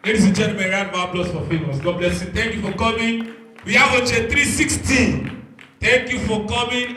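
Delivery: ordinary delivery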